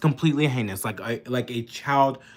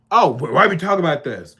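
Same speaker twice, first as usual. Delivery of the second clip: fast, louder mock whine of a dismissive critic; mocking quote; 3 semitones higher, 6 dB louder, faster than usual. Ranting vlogger mimics critics who dodge the Epstein question, mocking. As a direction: sneer it as if brushing the topic off.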